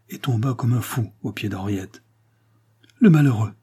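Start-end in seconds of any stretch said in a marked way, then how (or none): 3.0-3.5 loud, higher-pitched dismay, falling away; dismayed lament. A narrator's reading. Englishman watching Octave throw himself at Henriette, dismayed. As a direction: lament with consternation. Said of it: "soupira l'Anglais en le suivant d'un air consterné".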